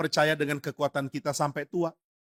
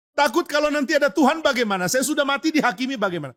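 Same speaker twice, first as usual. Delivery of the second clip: put-on much higher, anxious cry of an old man; fearful father; 9 semitones higher, faster than usual. Dying father tells son he fears God's judgement, much higher and anxious. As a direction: sound scared of judgement after death. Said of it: "Tapi takut".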